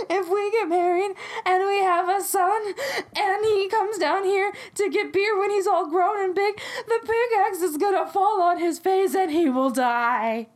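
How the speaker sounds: high, hammed-up tearful wail; imitating a hysterically weeping bride-to-be; mock sobbing. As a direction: blubber out an imagined tragedy. Crying [name] retells her imagined tragedy.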